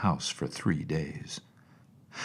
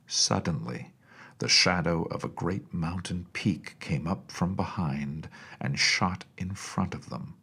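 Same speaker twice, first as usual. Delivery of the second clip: ordinary delivery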